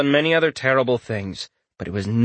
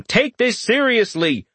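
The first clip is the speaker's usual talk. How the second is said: mock scream; 11 semitones higher than usual. A narrator's reading. Quest seeming to scream at Richter, high urgent cry. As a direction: stress it insistently in a raised pitch. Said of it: "so clearly screaming".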